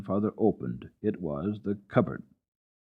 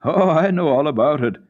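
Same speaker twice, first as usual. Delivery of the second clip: loud reply from below; calling up; 8 semitones higher, 8 dB louder than usual. A narrator's reading. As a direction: call back up to her. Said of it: "sounded from below".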